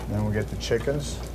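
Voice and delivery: ordinary talk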